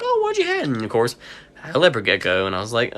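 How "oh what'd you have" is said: jumps way up into a sugary falsetto; mock-polite sky-high question; fake interest. Fake interest in customer's lizard.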